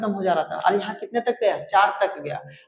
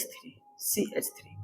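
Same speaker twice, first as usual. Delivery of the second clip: whisper; 16 dB quieter, slower than usual. Real, not acted. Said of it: "unvoiced lines".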